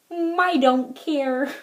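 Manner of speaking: slow, drawn-out don't-care retort; mock nonchalance. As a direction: brush it off with exaggerated slowness. Acts out her defiant reply.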